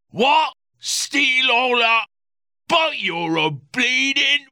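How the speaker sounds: put-on very high, slow indignant drunk; outraged drunken reporter; sputtering drunk outrage. A narrator's reading. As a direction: splutter in disbelief.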